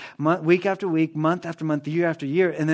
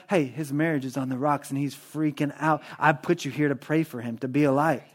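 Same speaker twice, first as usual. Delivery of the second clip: soft voice of the Spirit; 4 semitones lower, 6 dB quieter than usual. Acted. Holy Spirit voiced speaking.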